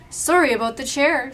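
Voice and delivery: calling out. A narrator's reading. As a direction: call it out as a departing Santa. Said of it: "Santa called".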